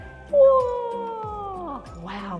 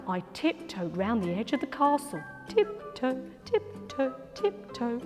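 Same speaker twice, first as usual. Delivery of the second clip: soft, quiet tiptoe chant; hushed tiptoe; sneaky hush; 3 semitones lower, 10 dB quieter than usual. Acted. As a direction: creep past unseen. Sneaking around castle.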